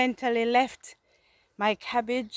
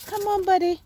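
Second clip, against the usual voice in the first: coaxing a wild bird; 6 semitones higher, 3 dB louder, faster than usual. Real, not acted. Coaxing second pigeon free.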